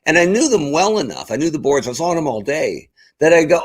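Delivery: ordinary delivery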